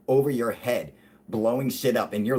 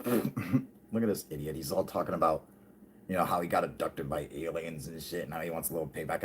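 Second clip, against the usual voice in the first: scornful mutter; 5 semitones lower, 6 dB quieter than usual. Retold or imitated. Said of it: "they start looking at each other like".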